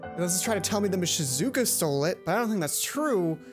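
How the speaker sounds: normal talking voice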